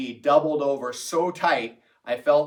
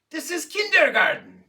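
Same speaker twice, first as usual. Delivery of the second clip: put-on exasperated Russian-accented professor, raised pitch; 13 semitones higher, 5 dB louder than usual. Professor scolds the class gently, in Russian accent. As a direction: plead like a frustrated Russian teacher. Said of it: "in his Russian accent".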